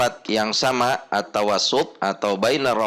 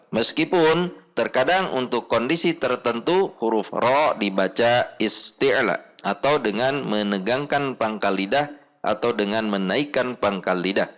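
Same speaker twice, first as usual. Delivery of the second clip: ordinary talk, nothing marked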